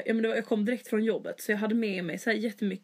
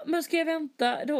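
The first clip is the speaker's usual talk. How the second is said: incredulous; 7 semitones higher than usual. Retold or imitated. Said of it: "och jag bara".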